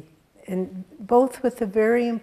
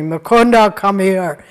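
louder, stern summons; stern call; 2 semitones higher, 13 dB louder than usual. Retold or imitated. Guru summoning the disciple who ignored him, stern loud call. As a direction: order him over, firm and loud. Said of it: "called him very sternly".

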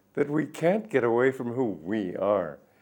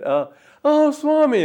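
bright, much higher and louder hello; eager phone greeting; 10 dB louder than usual. Retold or imitated. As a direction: call out a friend's name happily down the phone. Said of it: "just from the tone of my voice".

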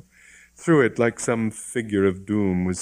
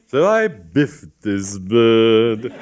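slow, savoured louder boast; relished boast; 3 semitones higher, 8 dB louder, much slower than usual. Acted. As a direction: savour every word of the punch. Bishop relishes the punch.

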